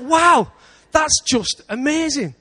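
loud, high burst of wonder, then slowing; excited wonder; amazed exclaim. Retold or imitated. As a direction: shout out the wow in awe. Retells his own amazed thought.

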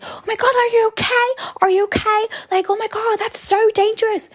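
put-on overdone American valley accent, high and bright; american accent in german. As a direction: gush like a shocked American passerby. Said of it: "amerikanischer Akzent". (übertriebener amerikanischer Akzent)

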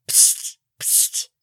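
sharp voiceless "psst" to get attention; imitated "psst" noise. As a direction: hiss a secretive "psst" twice. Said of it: "you all hear this noise come from".

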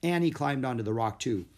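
plain reading voice

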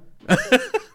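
high villain cackle; evil chuckle. Pretend. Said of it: "Tu sabe dar uma risada de vilão?". (risada de vilão)

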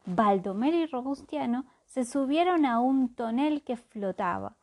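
plain reading voice

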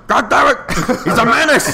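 high-pitched, outraged mock rant; hammed-up irate boss through laughter; J. Jonah Jameson. Newspaper boss shouting from a car, mock outrage. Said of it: "Jonah Jameson was one of the cars".

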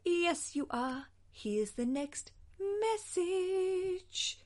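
high, drawn-out teasing lilt; playful insistence. Pretend. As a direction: stretch it out high to brush off the co-host's doubt. Teasing co-host, hurrying to next message.

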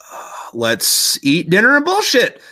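drawn-out then emphatic, acted-out choice; mock decision. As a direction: land on the choice with comic relish. Soldiers decide to stay.

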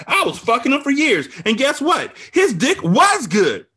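high, loud taunting tirade; vengeful gloating. A narrator's reading. Friend reveals her revenge affair, loudly gloating. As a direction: build to a triumphant shout, stretching key words.

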